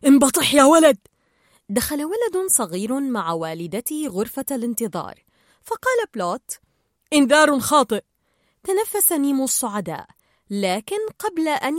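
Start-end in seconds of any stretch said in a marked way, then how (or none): 0.1-1.0 loud, sharp order; sharp command. A narrator's reading. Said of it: "وقال جيل بحدة". 7.1-8.0 loud announcement to the group; called out. A narrator's reading. Character calls out false alarm.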